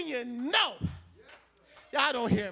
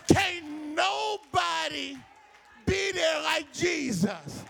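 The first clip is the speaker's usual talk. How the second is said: preaching at full force; 4 semitones higher than usual. Acted. Pastor urging the church not to depend on people, preaching at full force. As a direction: drive it home to the congregation, full voice.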